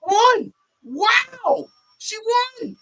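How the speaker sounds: very high amazed cries; excited cheering. Retold or imitated. Kendall cheering Carly's win, high and excited. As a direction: cheer it like a stunned fan. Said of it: "She's like".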